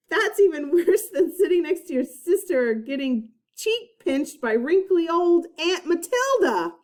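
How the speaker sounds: laughing while reading. Real, not acted. Reader laughing at text.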